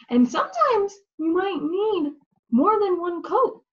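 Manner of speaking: ordinary talk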